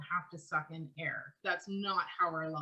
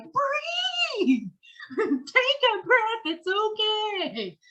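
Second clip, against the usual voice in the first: high, loud, slowed-down plea in another voice; silently begging a talker to stop and breathe, much higher and louder; inner plea to breathe; 14 semitones higher, 10 dB louder, much slower than usual. Retold or imitated. Listener in her head begging a nonstop talker to breathe, high slow shout. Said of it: "in your head, you're like".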